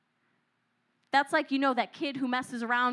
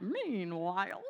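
bored mumbled meanwhile; 4 semitones lower, 6 dB quieter, slower than usual. Retold or imitated. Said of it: "I like some of you how went".

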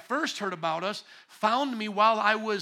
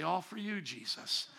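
soft reverent; 2 semitones lower, 8 dB quieter than usual. Retold or imitated. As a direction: whisper it out reverently. Quiet devotion at the end.

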